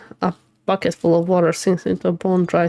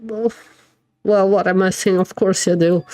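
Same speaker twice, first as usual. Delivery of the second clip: ordinary talk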